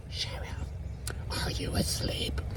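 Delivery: faint unvoiced whisper. Grandma waking her sister, whispered again. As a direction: barely breathe the words. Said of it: "she come in there going".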